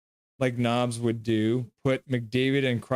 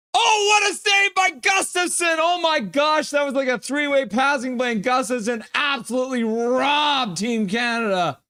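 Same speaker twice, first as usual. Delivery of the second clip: much higher and louder, yelling in amazement; excited shout; 12 semitones higher, 5 dB louder than usual. Real, not acted. Fan watching hockey shouting at an amazing save. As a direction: erupt like a fan who just saw a robbery save.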